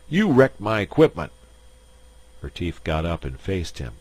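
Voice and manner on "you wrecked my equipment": loud, higher angry accusation. Acted. Bullethead yelling at Retief over the wrecked tower. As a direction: blast the stranger for the wreck. Furious shill after tower falls.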